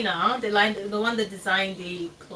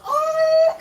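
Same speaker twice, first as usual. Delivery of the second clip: high, loud melting wail; mock crying; 7 dB louder than usual. Auntie moved by a child's compliment, hammed-up tearful wail. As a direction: re-enact bursting into happy tears. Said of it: "and I started crying".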